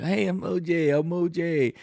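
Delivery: cheesy celebrity hello in a high voice; mock O J. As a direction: play a fake friendly O J. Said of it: "he's like".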